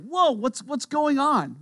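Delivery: taken off guard, voice jumping up; startled. Acted. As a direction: act out a baffled party guest. Acting out startled party guests.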